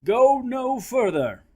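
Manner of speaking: slow, high, loud command; commanding shout. Acted. Saint Columba shouting at the monster, slow commanding shout. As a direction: hold up your hand and order the beast back. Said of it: "shouted at the monster".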